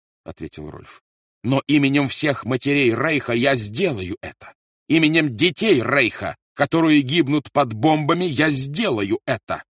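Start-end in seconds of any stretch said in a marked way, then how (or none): 1.4-9.6 loud, high, ringing oath; fanatic declaration. A narrator's reading. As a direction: proclaim it like a fanatic, slowing at the end. Rolf answers with fanatic fervor. (громкая фанатичная клятва)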